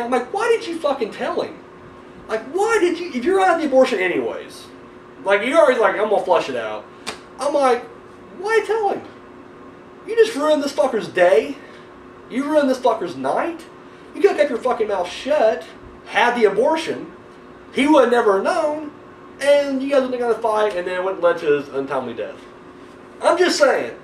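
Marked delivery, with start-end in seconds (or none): 0.3-22.4 high-pitched, incredulous rant; worked-up armchair telling-off; exasperated scolding. Acted. Ranting at the character he watches. 23.2-23.9 high-pitched "I'm just saying"; mock-defensive disclaimer. Real, not acted. Backing off after his rant.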